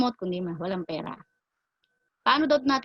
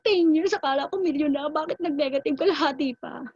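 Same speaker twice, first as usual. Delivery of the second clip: mock complaining client, pitched higher; upset client; 7 semitones higher, 3 dB louder than usual. Client upset about his negative returns, mock indignant higher voice. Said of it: "Sabi niya".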